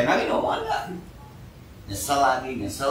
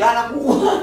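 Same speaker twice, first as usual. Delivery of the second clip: higher, louder indignant snap; indignant retort; 9 semitones higher, 7 dB louder than usual. Retold or imitated. Preacher voicing a parent defending a naughty child, loud indignant retort. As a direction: fire back in defence of your own kid.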